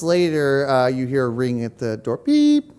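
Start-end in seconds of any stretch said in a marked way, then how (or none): 2.2-2.7 buzzer beep. Acted. Imitating door buzzer.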